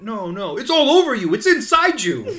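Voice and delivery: high, loud, laughing accusation; mock accusing guard. Guard accusing the couple of eating the fruit, mock outrage through laughter. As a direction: ham up a guard catching them red-handed.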